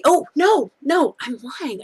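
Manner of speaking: sudden high oh no; surprised. Real, not acted. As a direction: catch yourself mid-sentence. Catches herself ending too early.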